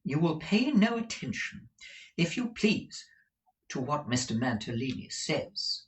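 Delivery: high, measured instruction; firm Madame Mantalini. Acted. Wife tells Ralph to ignore her husband, high and firm.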